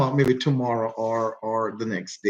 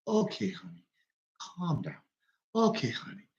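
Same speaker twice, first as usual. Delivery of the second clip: soft, high, soothing imitation of Pops; gently calm down his upset wife; imitating calm stepdad; 4 semitones higher, 9 dB quieter than usual. Soothing his wife, soft high mock calm. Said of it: "Pops is just never ... always calm".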